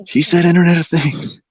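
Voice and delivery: teasing him for the shill word; mock accusation. Teasing a friend for saying the buzzword, high mock gotcha.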